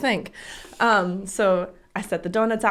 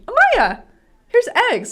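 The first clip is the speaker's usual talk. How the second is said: high, louder sing-song offer; bright eager offer; 14 semitones higher, 7 dB louder than usual. Retold or imitated. Mom offering eggs to daughter cheerfully. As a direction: present it like a treat.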